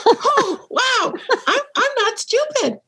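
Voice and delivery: high and slow, amazed discovery; delighted realization. Retold or imitated. Reliving the kitchen moment for the host, high and amazed. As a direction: let the wonder dawn slowly, voice up high.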